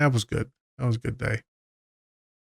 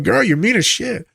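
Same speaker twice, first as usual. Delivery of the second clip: sassy teasing; 10 semitones higher, 3 dB louder than usual. Retold or imitated. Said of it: "I'm like".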